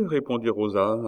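ordinary delivery, nothing marked

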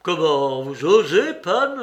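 loud, indignant challenge rising in pitch; yelled outrage. A narrator's reading. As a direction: demand how he dares. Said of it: "hurla à son tour Wróblewski".

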